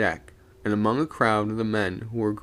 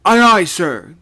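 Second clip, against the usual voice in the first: loud, higher shouted aye aye; called reply; 10 semitones higher, 13 dB louder than usual. A narrator's reading. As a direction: answer loud from the rigging. Sailor answering from aloft.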